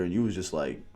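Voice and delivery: ordinary talk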